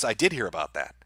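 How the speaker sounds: normal talking voice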